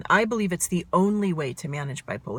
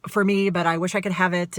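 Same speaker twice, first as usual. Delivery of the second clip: manic fast talk. Shown as a demonstration. Showing viewers how her manic brain sounds, fast and rushing. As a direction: rattle it off like a racing mind. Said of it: "that's what my brain sounds like right now".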